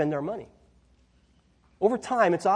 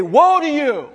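louder, higher mock shout at a driver; mock road-rage curse; 7 semitones higher, 8 dB louder, slower than usual. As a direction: yell it at someone who cut you off, half in jest. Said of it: "I'm gonna say".

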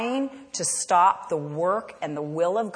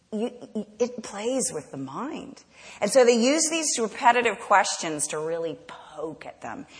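ordinary talk, nothing marked

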